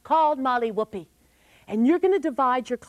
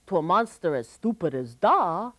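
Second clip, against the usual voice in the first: usual speaking voice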